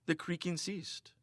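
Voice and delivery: ordinary delivery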